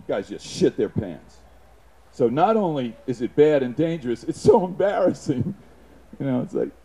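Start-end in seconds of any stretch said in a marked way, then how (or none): none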